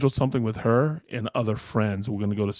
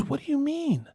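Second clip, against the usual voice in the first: high, softer imitation of her question; puzzled disbelief; 5 dB quieter than usual. Retelling her reaction to planning for a month, puzzled disbelief. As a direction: mimic her puzzlement in a much higher voice.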